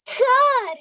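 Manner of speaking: loud, high cry of protest; protesting call. Real, not acted. Student appealing to the teacher, protesting call.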